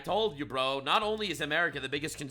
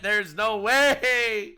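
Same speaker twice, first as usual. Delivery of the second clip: disbelieving; 5 semitones higher, 5 dB louder, much slower than usual. Real, not acted. Disbelief, drawn out.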